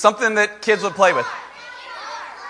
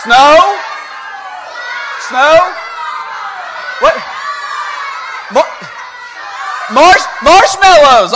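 excited shout; 7 semitones higher, slower than usual. Real, not acted. Excited shouting out audience answer.

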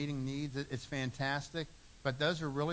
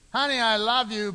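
higher, louder "Honey, I love you"; mock loving declaration; 7 semitones higher, 9 dB louder than usual. Husband declaring love to his wife, higher and louder. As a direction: proclaim it brightly, like you mean it.

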